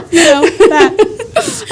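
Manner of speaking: real high laugh into the words; breaking up with laughter. Real, not acted. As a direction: laugh it out and drag the words slowly. Laughing at husband's joke.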